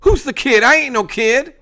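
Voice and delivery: put-on high, loud offended kid; indignant kid. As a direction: bristle at being called kid. Ellie indignant.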